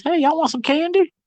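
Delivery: high, sing-song granny offer; grandma tempting the kids with candy, high and sing-song; sweet grandma voice. Retold or imitated. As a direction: pitch up like a cheery old grandma.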